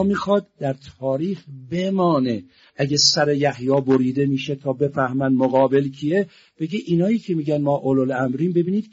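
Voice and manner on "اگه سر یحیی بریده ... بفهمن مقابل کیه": ordinary talk, nothing marked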